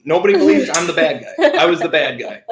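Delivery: ordinary talk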